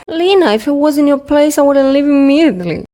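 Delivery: high, fast mocking voice of others; judgy onlookers. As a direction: mimic people lecturing her. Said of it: "People would say that".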